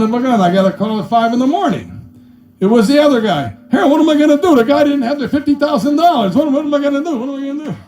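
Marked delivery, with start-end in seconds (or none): 3.7-7.8 panicked whine. Retold or imitated. Creditor calling at dawn, panicked whine. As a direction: beg for help in a panic.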